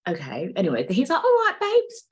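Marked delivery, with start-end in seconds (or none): 1.2-2.1 put-on very high, loud, silly boyfriend; hammed-up squeaky mimicry of a boyfriend. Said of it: "Okay, he didn't talk like that".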